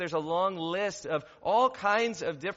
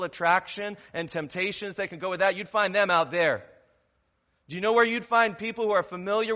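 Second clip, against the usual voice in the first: building sermon refrain; 3 semitones higher, 6 dB louder than usual. Acted. Rhetorical questions, building intensity.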